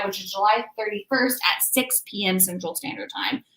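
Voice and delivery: normal talking voice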